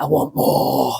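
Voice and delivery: whispered greedy gremlin croak. Retold or imitated. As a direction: rasp it like a greedy little gremlin. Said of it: "like a greedy gremlin voice".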